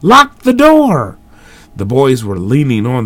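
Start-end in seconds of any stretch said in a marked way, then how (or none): 0.0-1.2 high frantic yell; terrified shout for the lock; scared shouting. A narrator's reading. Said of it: "shouting in scared excitement".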